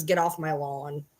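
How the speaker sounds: put-on slow, low old-man grumble; lightly voiced grumpy old man. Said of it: "she's an old man who says".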